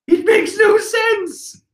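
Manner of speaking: very high, slow, yelled disbelief; exasperated shout. Real, not acted. Ranting to viewers about attacking Big Mom's kids, shouting. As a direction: throw up your hands and yell it.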